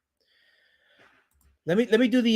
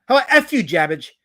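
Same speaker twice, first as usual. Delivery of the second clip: defiant telling-off; 7 dB louder than usual. Real, not acted. Streamer telling off a banned commenter, defiant. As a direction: throw it back at the heckler, loudly.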